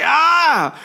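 high-pitched "ah!" of sudden pain; mock cry from a shot to the chin. Comic book character shot in the chin, high pained cry.

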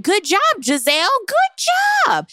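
lightly squealed praise; mock cheering. Host cheering Gizelle, rising squeal. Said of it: "I was like".

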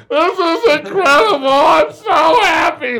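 high, loud sobbing joy; crying with joy. Retold or imitated. Telling how he cried at the coach's news, loud crying joy. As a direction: sob it out, overjoyed. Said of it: "I started hysterically crying".

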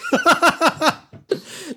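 sudden high laugh; high burst of laughter. Real, not acted. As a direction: crack up at the crow pun. Laughing at crow joke.

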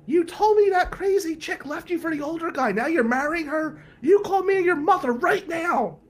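high, loud, frantic aunt's scream. Retold or imitated. Aunt screaming at Billy on the voicemail, high and shrill. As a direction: shriek it like a panicked aunt on voicemail. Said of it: "Aunt Mare screaming".

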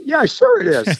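disbelieving brush-off; mock sarcasm at a prank caller; sarcastic. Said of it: "And I go".

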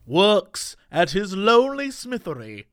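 storybook narrator. Acted.

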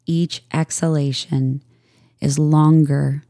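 calm, even voice with long pauses between phrases; unhurried yoga nidra guidance; guided meditation. Real, not acted. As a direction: guide listeners into stillness, leaving space after each phrase.

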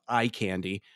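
normal talking voice

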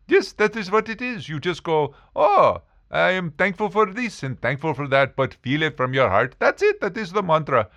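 high-pitched put-on guru voice, insistent; guru character. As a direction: explain the mantra as a caricature guru. Playing the Indian guru.

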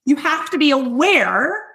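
ordinary talk, nothing marked

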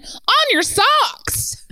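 put-on slow, high, laughing Southern accent. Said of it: "said the woman".

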